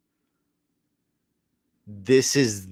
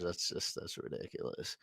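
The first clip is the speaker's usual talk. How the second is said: whisper; 4 semitones lower, 17 dB quieter than usual. Real, not acted. Aside under breath.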